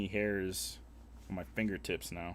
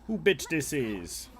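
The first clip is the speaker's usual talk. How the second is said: Who stretched slowly, falling tune; stress on Who; 5 semitones higher, 5 dB louder, slower than usual. Shown as a demonstration. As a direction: lean on Who, draw it out. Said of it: "It's my favorite phrase".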